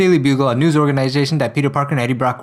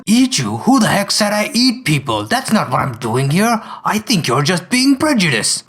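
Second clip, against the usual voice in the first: offended lizard; slower than usual. Acted. The lizard lightly voiced, offended at Brock. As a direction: huff that you're insulted.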